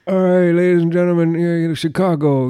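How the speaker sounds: loud, high-pitched throaty singer's voice. Acted. Podcaster as a rock singer greeting Chicago, loud throaty impression. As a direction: holler from the throat to a concert crowd. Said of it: "he's got that that deepness".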